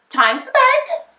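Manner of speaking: high, sing-song witch voice; a cheerful little witch yawning goodnight; Winnie's witch voice. A narrator's reading. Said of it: "said Winnie the Witch".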